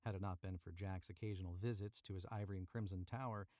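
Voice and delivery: plain reading voice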